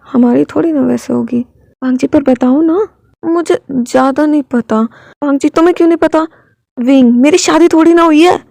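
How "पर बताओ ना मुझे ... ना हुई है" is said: ordinary delivery, nothing marked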